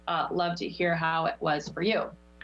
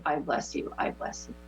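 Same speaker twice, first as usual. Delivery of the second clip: fading to whisper; 5 semitones lower, 4 dB quieter than usual. Shown as a demonstration. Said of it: "repeated affirmation fading out".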